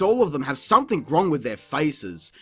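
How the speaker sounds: ordinary talk, nothing marked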